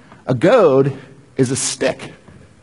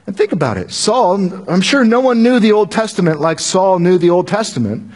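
ordinary delivery

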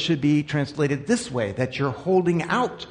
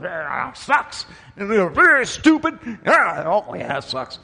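lightly acted office grumble, sighing complaints; mock grumbling; 4 semitones higher, slower than usual. Coworkers complaining at the water cooler, mock grumbling.

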